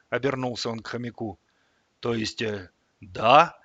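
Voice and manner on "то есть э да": hesitant, drawn-out yes; stall, then agree doubtfully; unsure. A narrator's reading. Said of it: "неуверенно протянул тот". (неуверенно, протяжно)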